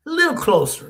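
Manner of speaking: very high coaxing voice; beckoning grandmother. Retold or imitated. Grandmother luring grandson to bend down, high beckoning. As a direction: draw him in with a high-pitched call.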